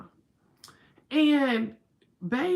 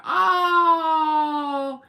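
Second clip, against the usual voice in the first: sudden loud cry of emotion, higher; tearful outcry; 8 semitones higher, 6 dB louder than usual. Real, not acted. Moved by a viewer's prayer story, tearful loud outcry.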